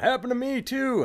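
a high mock call from a comedy club crowd; audience heckler. Audience member calls out in story.

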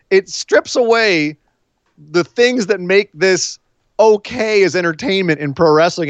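normal talking voice

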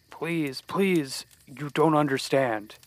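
desperate pleading; begging. Acted. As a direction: plead for mercy. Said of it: "he begged".

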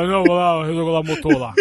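low, mocking mechanic's voice; deep comic impersonation, on the edge of laughing; engine-regulator voice. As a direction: parody that deep motor voice. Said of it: "Essa voz".

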